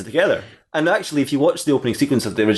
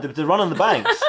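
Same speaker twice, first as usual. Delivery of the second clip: laughing; 9 semitones higher than usual. Acted. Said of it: "She goes".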